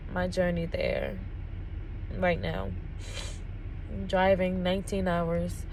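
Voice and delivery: softly holding back tears, with long pauses; emotional. Real, not acted. Driver telling viewers about leaving with her daughter, emotional. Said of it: "after I get all my cars out".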